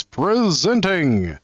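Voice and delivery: drawn-out, high-rising announcer's call; booming announcer voice. Acted. Card reader obeys instruction to stand up and announce, mock booming voice. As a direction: stretch it out like a grand introduction. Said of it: "say in a deep, booming voice".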